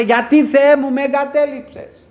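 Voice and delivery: a preacher voicing Jesus' cry of abandonment; anguished cry. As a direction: cry out to God as if forsaken. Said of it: "Φώναζε".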